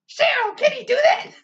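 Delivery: hammed-up nurse panic, shouted high; mock panic. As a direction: squeal it like flustered nurses.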